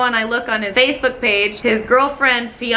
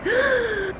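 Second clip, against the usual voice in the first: flustered gasp; 11 semitones higher, 4 dB quieter than usual. Retold or imitated. Flustered reaction to his advances.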